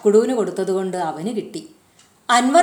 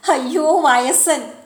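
slow, higher sing-song mockery; teasing; 6 semitones higher, 3 dB louder, slower than usual. A narrator's reading. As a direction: tease him as an old man, drawn out. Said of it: "Maithili കളിയാക്കി". (കളിയാക്കി നീട്ടി ഉയർന്ന സ്വരത്തിൽ)

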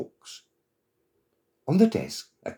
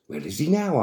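pitched up, eager narrated question; storybook excitement, higher voice; excited question; 7 semitones higher than usual. A narrator's reading. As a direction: ask eagerly, voice lifted. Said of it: "asked Pixie excitedly".

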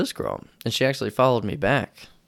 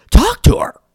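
loud high mock yell; 13 dB louder than usual. Friend yelling at him to talk to the girl, mock yell. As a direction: bark the order at him. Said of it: "Just yelled at me".

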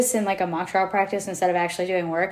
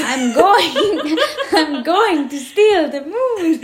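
higher, loud cartoon villain accent, laughing; Gru; 7 semitones higher, 5 dB louder, much slower than usual. Accent slipping into Despicable Me, laughing Gru impression. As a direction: slowly proclaim it like a cartoon villain, breaking into laughter. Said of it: "molds into, like, Gru".

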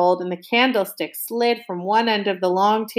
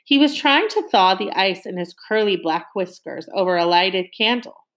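plain reading voice

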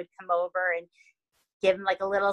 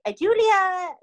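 high, louder sing-out of a name; mock official summons, pitched up; authorities calling out; 10 semitones higher, 5 dB louder than usual. Authorities calling Julia out of the church, high mock summons. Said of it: "authorities come and knocking to the church, and they say".

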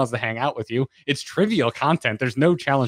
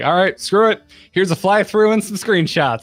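higher, louder exasperated quote; fed-up artist; 9 semitones higher, 6 dB louder than usual. Retold or imitated. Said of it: "some internal artist was like".

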